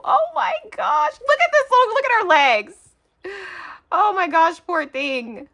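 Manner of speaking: high, loud delight; delighted squeal. Real, not acted. Vlogger showing a cute craft, squealing. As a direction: gush over the tiny craft.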